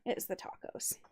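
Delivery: sheepish whisper; whispered excuse. Acted. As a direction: breathe it out embarrassed. Embarrassed excuse, unvoiced.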